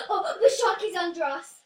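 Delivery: gasping loud alarm; startled gasp. Real, not acted. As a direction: gasp and blurt the warning. Spots the shark suddenly.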